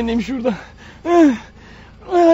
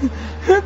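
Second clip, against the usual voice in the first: unhinged laugh; 8 semitones higher, 3 dB quieter than usual. Acted. Killer breaks down laughing.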